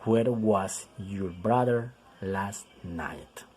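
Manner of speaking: drawn-out, word by word; teacher reading an example very slowly; very slow model reading. Shown as a demonstration. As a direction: stretch each word for learners.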